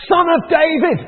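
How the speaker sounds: loud, high cry for help; shouting out. Retold or imitated. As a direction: call out over the crowd, high and loud. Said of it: "starts shouting out".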